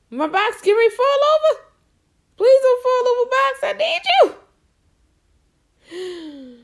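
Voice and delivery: excited pleading. Real, not acted. Box about to fall over.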